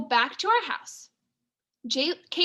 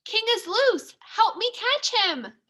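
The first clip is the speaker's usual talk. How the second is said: urgent call; 8 semitones higher, slower than usual. A narrator's reading. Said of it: "she screams".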